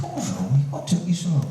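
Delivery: a lightly voiced wife asking what went wrong; voicing his wife. Wife's question voiced in story.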